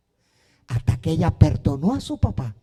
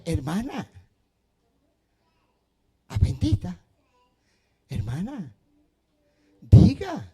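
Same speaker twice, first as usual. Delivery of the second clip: urging, rising to a sharp push on diga; impatient urging. Retold or imitated. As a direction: hurry her up, then snap out diga. Urging hesitant sister to speak. (apremio impaciente que termina en un diga fuerte)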